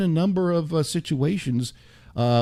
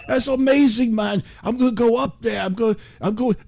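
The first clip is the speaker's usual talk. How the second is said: much higher, louder, delighted; suddenly thrilled, like he just woke up; excited Ozzy; 8 semitones higher, 3 dB louder than usual. Retold or imitated. Ozzy delighted by the rats in the radio attic, high and excited. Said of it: "he said".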